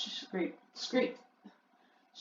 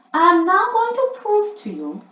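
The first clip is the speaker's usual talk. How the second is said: much higher, louder witch voice; Grand High Witch; 13 semitones higher, 11 dB louder than usual. A narrator's reading. Witch addressing her audience, high and loud. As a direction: boom it out like a shrill witch queen. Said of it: "she said".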